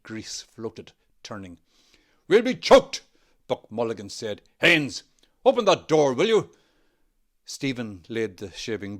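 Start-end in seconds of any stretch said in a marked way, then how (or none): 2.3-3.0 complaining loudly. A narrator's reading. Smoke filling the room. 4.6-6.5 calling out. A narrator's reading. Calling across the room.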